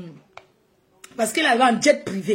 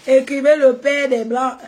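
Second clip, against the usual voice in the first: loud hype call; 3 semitones higher, 7 dB louder than usual. Real, not acted. Urging viewers to comment.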